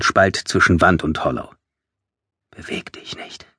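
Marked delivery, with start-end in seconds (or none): 2.5-3.5 barely audible whisper; whispered command. A narrator's reading. Narrator wills the Hollow still in a faint whisper. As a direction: whisper it under your breath.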